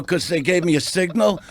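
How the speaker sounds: ordinary delivery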